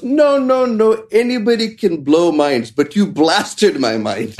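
put-on very high, insistent Indian accent, slowing to the end; imitated Indian accent. Indian fan correcting Russell, in a very high imitated Indian accent. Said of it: "And the Indian goes".

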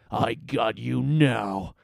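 slow, drawn-out villain voice; gloating captain. A narrator's reading. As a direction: gloat slowly over cornered prey. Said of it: "the captain said".

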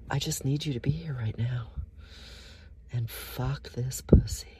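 a real turned-on whisper to the listeners; aroused whisper. Real, not acted. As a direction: whisper it like you can't hold back. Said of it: "I'm so worked up".

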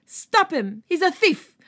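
high, loud cry for help; shouting. Acted. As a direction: yell to stop the thief. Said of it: "someone shouted".

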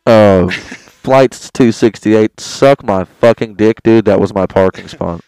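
loud slow furious radio call; angry pilot on radio. Acted. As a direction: bark it at the other flight.

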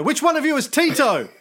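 shouted high question; loud demanding. Retold or imitated. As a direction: bark out the question. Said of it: "They go".

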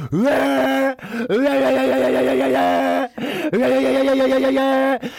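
loud, high, pulsing wail; imitated shower noise. Comic doing the Triton shower, loud droning wail. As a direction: wail like a straining electric shower. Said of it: "the fucking Triton shower's going".

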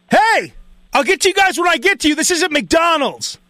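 high, rushed scolding, slowing at the end; mock irate cook. Cook turns on people in line, imitated irritated scolding. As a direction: rattle off a testy put-down, then stretch the last part.

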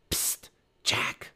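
hushed, whispered attention-getter; secretive stage whisper; urgent whisper. A narrator's reading. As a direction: whisper to someone across a dark yard. Annie calling secretly from hiding.

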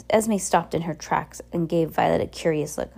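ordinary delivery, nothing marked

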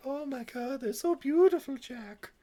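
put-on high, airy Lily with an odd accent; mock-dainty girl with a stray accent; accidental put-on accent. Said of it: "why she had an accent there".

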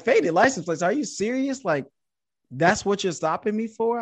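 high, indignant disbelief; incredulous smart aleck. Retold or imitated. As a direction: challenge it like a smart aleck who can't believe it. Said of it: "this is where the real smart aleck comes out".

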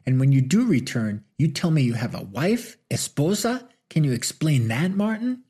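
furious peak of the tirade; angrier, last salvo. Acted. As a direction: fire the final accusation. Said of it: "Rosita's voice grew angrier".

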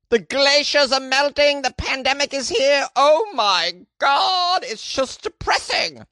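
put-on high, wailing Monty Python accent; slow, hammed-up despair in a Monty Python accent. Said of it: "It's like the Monty Python accent".

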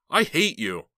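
high, loud blurted hate; blurted scream. Acted. Heather accidentally screams her hatred at her uncle, loud. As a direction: let it burst out in a scream. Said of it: "hadn't meant to scream it aloud".

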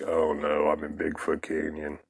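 a quiet oh-no to himself; hushed dread. Retold or imitated. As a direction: drop to a low, soft voice. Realizing where he is, again.

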